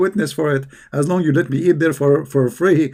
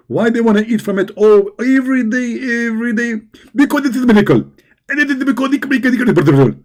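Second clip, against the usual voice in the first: Zakir Naik; 6 semitones higher than usual. Retold or imitated. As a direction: lecture like an excited preacher. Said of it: "Zakir Naik, can you speak slower".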